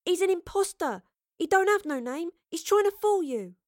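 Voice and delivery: high-pitched, urgent accusation; indignant, pleading warning; protesting. A narrator's reading. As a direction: protest insistently, pitched well up. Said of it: "Astrid protested".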